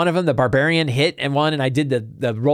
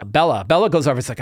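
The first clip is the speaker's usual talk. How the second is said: normal talking voice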